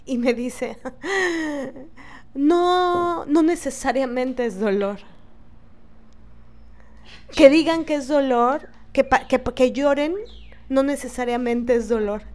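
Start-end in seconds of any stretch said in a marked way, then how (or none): 0.8-2.3 a short, high, mocking laugh; scornful little laugh. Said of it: "burlándose". 2.4-12.2 retelling the nurse who laughed at a patient's pain, mocking. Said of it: "burlándose".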